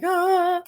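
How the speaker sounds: a high, tense little "Ah"; jittery caffeine cry. Retold or imitated. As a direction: squeak like you've had way too much coffee. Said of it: "that feeling like".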